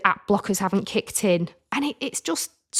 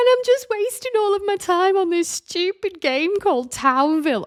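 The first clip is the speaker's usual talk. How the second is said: high, wailing confession through tears; tearful wail; 12 semitones higher than usual. Retold or imitated. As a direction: blurt it out high and upset. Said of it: "And I'm like".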